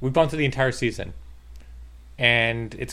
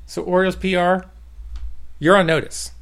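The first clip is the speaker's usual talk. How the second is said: higher, louder fake menace; mock threat; 7 semitones higher, 8 dB louder than usual. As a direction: threaten the PR office, half joking.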